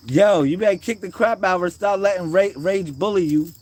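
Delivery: high, emphatic egging-on; loud urging. Real, not acted. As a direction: holler encouragement to fight back. Urging child to stop being bullied.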